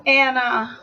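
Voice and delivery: usual speaking voice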